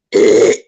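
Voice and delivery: loud mock throat clear; exaggerated phlegmy rasp of a throat clear; imitated throat clearing. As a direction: hack loudly, imitating her. Imitating her noisy throat clearing.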